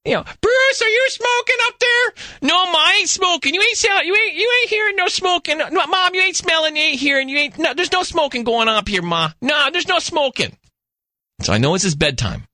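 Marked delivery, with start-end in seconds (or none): 0.4-2.1 very high, slow mock mom voice; hammed-up old mother, pitched way up; mother calling upstairs. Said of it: "he's like". 2.4-10.6 much higher whiny denial; mock teenage protest, pitched up; imitating Bruce as a guilty son. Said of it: "he's like".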